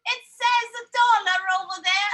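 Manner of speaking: put-on British accent. Son arguing price with mom, imitated British accent. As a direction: plead like a kid in put-on British. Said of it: "my son kept talking British".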